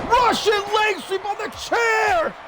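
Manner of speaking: high, urgent play-by-play shout; wrestling commentator's high-pitched yell; excited shouted call. Real, not acted. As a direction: cry out the shocking move. Calling a violent wrestling move.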